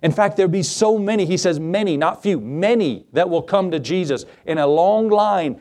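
normal talking voice

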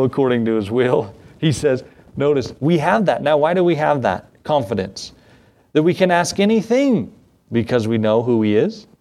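ordinary talk

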